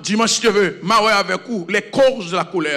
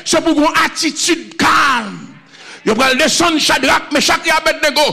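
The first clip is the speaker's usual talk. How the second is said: fervent preaching; 6 semitones higher, 4 dB louder than usual. Acted. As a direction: preach it out to the congregation with full force. Preaching at full force. (prêche fervente et sonore)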